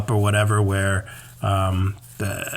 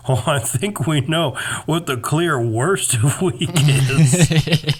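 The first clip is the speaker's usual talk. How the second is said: higher, slow put-on voice; mocking quote; 5 semitones higher, slower than usual. Teasing the co-host with their own opening, mocking impression. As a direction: drag it out to mock the co-host. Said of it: "who started this segment by saying".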